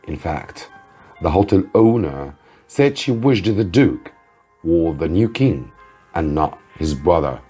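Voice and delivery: usual speaking voice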